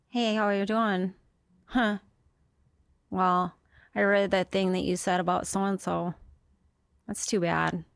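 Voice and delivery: deadpan Minnesotan, giving nothing away; flat affect. Acted. Checkout-line acquaintance ambushes comedian with small talk, flat affect. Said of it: "like, a flat affect".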